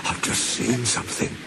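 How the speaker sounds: soft, frightened quaver; shaky voice. A narrator's reading. Toby telling Thomas of a sighting, shaky. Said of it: "said Toby in a shaky voice".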